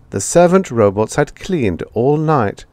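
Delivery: plain reading voice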